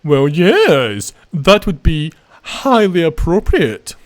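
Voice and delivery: mock-posh, drawn-out and swooping; posh accent. Gamer hamming up the inspector's reply to his invitation, posh accent. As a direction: put on an exaggerated posh party accent. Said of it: "he's gonna put on a posh accent".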